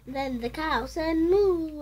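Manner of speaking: ordinary delivery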